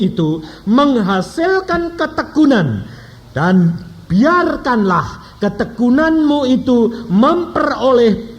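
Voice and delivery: slow, loud, high solemn Bible reading; scripture reading. Acted. Preacher reads Yakobus to congregation, slow loud proclamation. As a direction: proclaim each phrase slowly.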